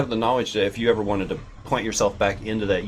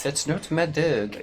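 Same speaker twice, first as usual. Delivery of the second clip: slow mock French accent; Pink Panther French; 4 semitones higher, 4 dB quieter, slower than usual. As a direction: answer drawn out, in a movie Frenchman's accent. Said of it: "I do the Pink Pan".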